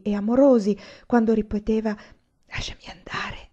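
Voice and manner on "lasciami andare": pleading whisper. A narrator's reading. Olì begging Anania to let her go, whispered. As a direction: whisper Lasciami andare, soft and begging. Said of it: "La sua voce vibrava".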